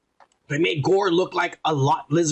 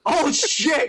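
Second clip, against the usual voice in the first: sudden surprise; 11 semitones higher than usual. Real, not acted. Sudden realization about Burger King.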